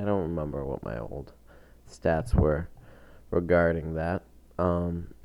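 normal talking voice

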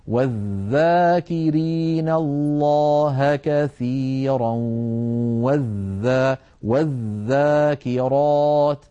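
slow drawn-out recitation, "والذاكرين" with strong voiced th; clearly sounded dhal. Shown as a demonstration. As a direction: chant "والذاكرات" with the dhal held. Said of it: "فلا بد من بيان صوتها".

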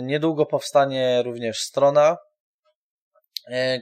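normal talking voice

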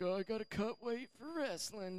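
high, soft imitation of the boyfriend; wrestler boyfriend. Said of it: "he's like".

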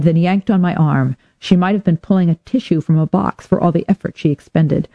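usual speaking voice

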